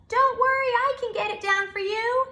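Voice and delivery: high, loud squirrel voice calling from the treetop; bright high squirrel shout from up high. Acted. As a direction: call down cheerfully like a little squirrel. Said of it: "he called".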